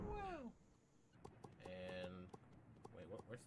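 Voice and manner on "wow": very high sarcastic wow; mock amazed wow. Real, not acted. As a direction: gasp it in fake wonder.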